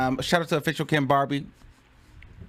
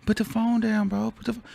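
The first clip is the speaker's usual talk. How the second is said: high, unhurried imitation; very calm; 7 semitones higher than usual. Imitating the rapper ordering a phone down, very calm. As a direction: mimic a cool, level order. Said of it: "It was very calm, like".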